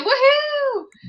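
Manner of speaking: high, loud woohoo; excited woohoo. Acted. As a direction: whoop back with joy. Echoing the cheer.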